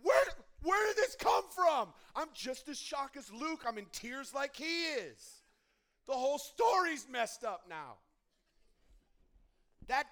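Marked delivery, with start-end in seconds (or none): 0.0-8.0 loud, higher disbelieving shout; shocked outcry. Retold or imitated. Young fan reacting to the twist, shocked loud outcry. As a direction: act out your younger self in shock. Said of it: "being like".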